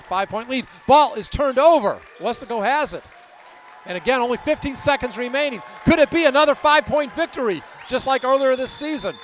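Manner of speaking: thrilled live commentary of the closing seconds; excited play-by-play. Real, not acted. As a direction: burst out loud on the turnover, then ease off. Calls the closing turnover.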